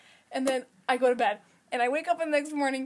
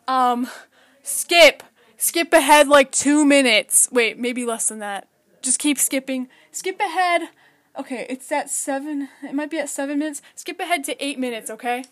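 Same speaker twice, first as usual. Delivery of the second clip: louder, urgent call to skip ahead; loud announcer voice; 9 dB louder than usual. Real, not acted. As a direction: call it out to the skippers. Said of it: "listened to me change my tone of voice".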